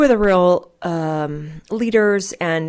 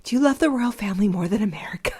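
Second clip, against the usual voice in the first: quick, higher-pitched imitation of a son; lightly voiced child quizzing mom; put-on incredulous kid question, rushed; son's questioning voice; 4 semitones higher, faster than usual. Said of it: "how he's like".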